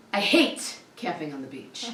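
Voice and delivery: ordinary delivery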